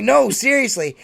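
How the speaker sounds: acted-out earnest insistence; insistent. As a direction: insist you really mean it. Retold argument, insisting he is serious.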